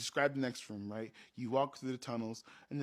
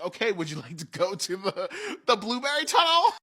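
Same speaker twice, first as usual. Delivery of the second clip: teasing question; 7 semitones higher, 9 dB louder than usual. Retold or imitated. GM teases the next tunnel.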